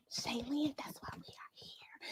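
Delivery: secretive unvoiced whisper. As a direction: lean in and whisper it. Confiding to child viewer.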